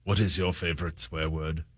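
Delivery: low, soft, hushed interviewer voice; James Lipton impression. Shown as a demonstration. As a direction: drop low and murmur the question. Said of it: "Try yours".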